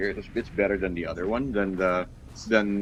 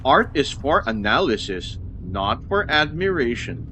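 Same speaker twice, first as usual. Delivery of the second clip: reading aloud; 4 semitones higher, 4 dB louder than usual. Acted. Reads the in-game memo aloud.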